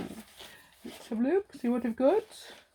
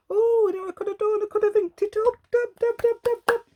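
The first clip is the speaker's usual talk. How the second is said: much higher, louder and faster, bursting with an idea; giddy high-pitched dub dub dub while dabbing ink; sudden excitement; 13 semitones higher, 6 dB louder, faster than usual. Real, not acted. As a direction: squeal it out as the idea hits.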